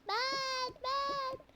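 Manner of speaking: high eager me me; excited child calling; eager kid. Acted. Kid begging to be picked.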